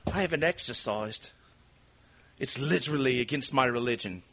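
lightly put-on Welsh singer voice; a hint of Welsh impression; Charlotte Church. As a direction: lightly mimic a Welsh celebrity. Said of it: "that was my Charlotte Church".

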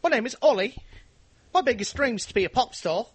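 high, loud Essex lad impression; hammed-up pop hopeful from Essex. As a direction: belt it out choppy and high. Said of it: "And he says".

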